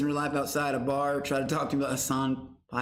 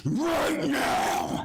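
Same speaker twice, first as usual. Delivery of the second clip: hype shout; 10 semitones higher than usual. Real, not acted.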